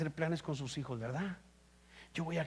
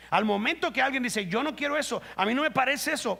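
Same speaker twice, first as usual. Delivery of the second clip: full-force preaching; 6 semitones higher, 5 dB louder than usual. Acted. Preaching peak, quoting a rebel.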